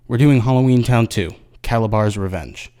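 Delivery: usual speaking voice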